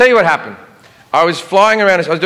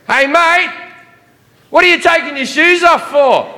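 much higher, thick ocker Aussie voice; broad Australian accent; 12 semitones higher than usual. Retold or imitated. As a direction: play a cheeky Aussie airport guard. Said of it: "the T S A guy goes".

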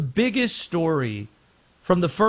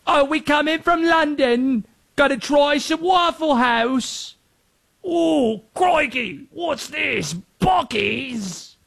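higher, hammed-up British tourist voice; bad British accent; 9 semitones higher, slower than usual. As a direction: do a cheesy London tourist accent, badly. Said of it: "My accents are awful".

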